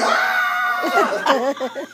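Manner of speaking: high cry of relief and pain, laughing; pained yell. Retold or imitated. Pastor imitating his stepdad hollering in the drill story. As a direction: holler out high and loud as the pressure releases. Stepdad yelling as blood drains.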